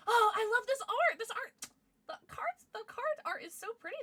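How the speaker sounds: very high squeal of delight; high-pitched adoration; gushing. Real, not acted. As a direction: coo over it in a high voice. Gushes over card art.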